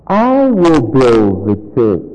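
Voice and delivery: slow, weighty emphasis on "I"; stress on I. Shown as a demonstration. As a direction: draw out "I" and let the rest descend. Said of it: "putting the emphasis on a different word".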